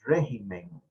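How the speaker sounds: "Régimen" with the accent on RÉ; RÉ-gimen, first syllable stressed. Shown as a demonstration. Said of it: "tiene el acento en la E".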